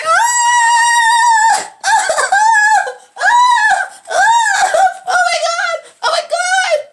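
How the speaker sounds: high, loud mock scream with laughter; the burning villain's high, loud mock scream; hammed-up screaming.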